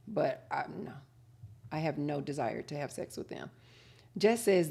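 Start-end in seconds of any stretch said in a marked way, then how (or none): none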